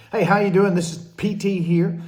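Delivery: loud, high show opener; excited intro. Real, not acted. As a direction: greet the viewers with a burst of energy.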